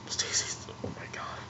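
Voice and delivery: whispered to self after a slip; frustrated whisper. Real, not acted. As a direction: drop to a breathy whisper at your own mistake. Flustered, loses track of a name.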